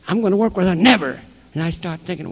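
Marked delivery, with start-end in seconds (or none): 0.1-1.2 high, loud scoffing retort; indignant refusal. Retold or imitated. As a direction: act out an outraged "no way". Klansman refusing to work with her.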